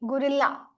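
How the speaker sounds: "Gorilla" as gu-ril-LA, leaning on the L; emphasis on L. Shown as a demonstration. Teacher correcting a student, "Gorilla" with emphasis on the L. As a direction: press on the L sound in "Gorilla". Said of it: "The, the emphasis is, is on L".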